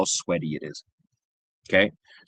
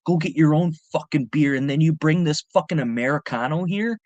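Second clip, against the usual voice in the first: higher-pitched mock angry rant; retold freak-out; 5 semitones higher than usual. Mexican cowboy berating the bodyguard over his kid, freaking out. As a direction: imitate an outraged father telling someone off. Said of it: "He starts freaking out on the bodyguard".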